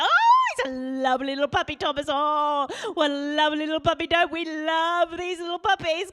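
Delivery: exaggerated high baby talk; cover-up puppy talk. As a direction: gush over the puppy to cover the swearing. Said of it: "if she can hear him swearing at the puppy".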